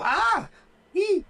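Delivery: high yelp then a squeak, like a hurt toy; mock yelp of a bitten owner; squeaky yelp. Shown as a demonstration. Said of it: "You don't wanna go".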